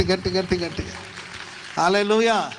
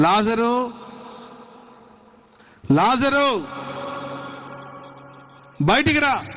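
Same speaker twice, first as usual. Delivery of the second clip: calling out; 6 semitones higher, much slower than usual. Acted. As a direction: call him out of the tomb, raised high. Jesus calls Lazarus from tomb.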